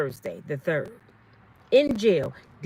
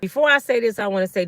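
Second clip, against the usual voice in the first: ordinary talk, nothing marked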